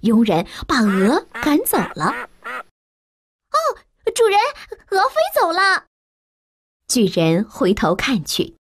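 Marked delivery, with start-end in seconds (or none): 3.5-5.8 higher, alarmed servant; maid crying out. A narrator's reading. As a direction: maid distracts the giant. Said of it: "servant distracting giant". (惊呼的女佣)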